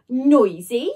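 loud, indignant exclaim. A narrator's reading. Jemima exclaiming at Ned's excuse, loud. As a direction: throw the word back at him. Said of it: "she exclaimed".

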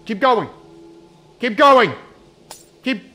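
loud, high frantic urging; frantic shouting. Real, not acted. Player fleeing the monster, frantic shouting. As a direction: yell at yourself to run.

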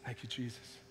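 very soft, low murmur; whispered thanks. Real, not acted. Pastor softly thanking Jesus before reading, very quiet. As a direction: breathe a quiet prayer.

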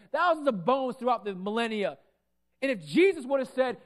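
usual speaking voice

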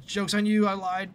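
loud, high gotcha; sudden high, loud reveal; gleeful taunt. Pretend. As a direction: burst out with a mock-triumphant taunt. Taunting the mob after coaxing.

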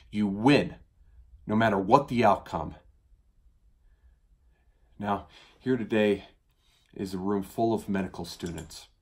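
building to loud, fervent oratory; ringing appeal to a roomful of students; impassioned plea. Acted.

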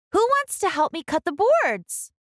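Ruby's voice. Acted. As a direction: ask brightly for a helper. Ruby asks again.

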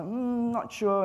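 high, drawn-out show of doubt; hesitant, unsure. Acted. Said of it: "never show weakness".

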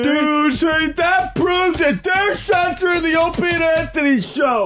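outraged shout. Real, not acted. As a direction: rant at the censors. Outraged about censored radio.

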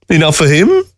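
high, derisive question; scoffing. A narrator's reading. Mockingbird answering Fox's rebuke, scoffing. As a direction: scoff it back in disbelief. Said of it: "she scoffed".

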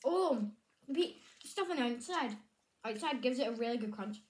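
speaking between bites; talking while chewing. Real, not acted. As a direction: mouth partly full of candy. Seen 0: Candy goes into her mouth at -2; hand at mouth chewing while speaking.

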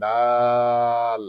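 দাল with the vowel drawn out for four beats; দাল stretched very long and steady; elongated vowel. Shown as a demonstration. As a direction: hold the long aa in দাল on a level tone. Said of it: "চার আলিফ পরিমাণ টেনে".

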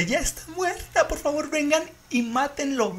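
high, slow begging; desperate high plea. Retold or imitated. Caller begging police to kill the chimp, desperate. Retold frantic 911 call.

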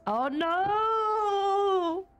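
usual speaking voice

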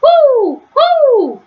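high hooting owl call. Acted. Owl hooting in the dark woods, high hoot. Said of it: "it was an owl far away".

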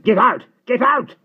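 very high, full-force yell; furious shout. A narrator's reading. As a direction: scream at the trespasser to leave. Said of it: "All at once he was shouting".

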